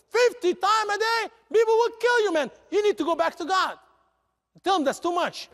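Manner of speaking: high, loud disbelief; shocked Moses. Acted. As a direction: react like it's outrageous. Moses shocked at fifty prayers.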